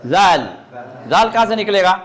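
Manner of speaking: normal talking voice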